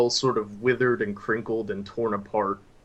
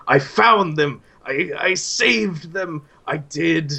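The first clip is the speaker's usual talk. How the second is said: high, loud, frantic voice of a crazed man; a mad wanderer raving at the campfire; manic madman; 7 semitones higher, 6 dB louder, slower than usual. Acted. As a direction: cry it out like a man gone mad with triumph. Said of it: "and he's still cackling".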